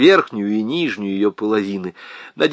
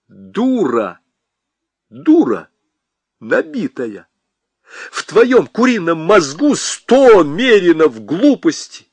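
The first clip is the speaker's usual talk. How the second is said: building louder; angry; 5 semitones higher, 5 dB louder, slower than usual. Acted. Husband yells insults at wife. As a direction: hurl the insults louder each time.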